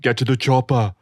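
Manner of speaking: put-on low Austrian-accented action hero; deep, lightly Austrian action star; Arnold Schwarzenegger impression. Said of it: "Arnold Schwarzenegger".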